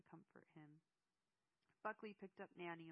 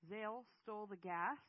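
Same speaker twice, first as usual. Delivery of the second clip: loud, higher, incredulous demand; raised-voice outrage; yelled question; 4 semitones higher, 11 dB louder than usual. A narrator's reading. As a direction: shout the question in disbelief. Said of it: "Eamon yelled".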